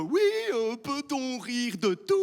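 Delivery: high, drawn-out mocking voice; mocked question-askers. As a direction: parody someone asking a tired question. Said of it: "pour les adeptes de la question". (voix moqueuse, aiguë et traînante)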